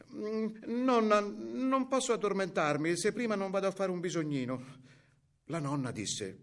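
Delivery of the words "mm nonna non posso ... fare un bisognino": put-on hesitate, then plead like a child; little girl's excuse. A narrator's reading. Girl asking grandmother to step out, high pleading child. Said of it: "Allora disse".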